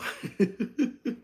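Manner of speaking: a quick, airy laugh that rises; amused exhale of a laugh; breathy laugh. Real, not acted. Laughing at own joke.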